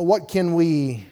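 normal talking voice

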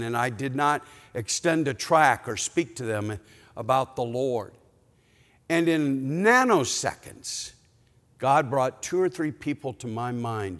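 ordinary talk, nothing marked

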